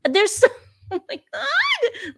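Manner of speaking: high-pitched outburst with a laugh; shocked disbelief. Real, not acted. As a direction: gasp it out high, half laughing. Shocked reaction to the played clip.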